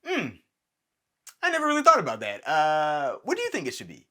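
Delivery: high, loud, fast surprised reply. Retold or imitated. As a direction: caught off guard, blurt it out high. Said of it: "Christopher Nolan was like".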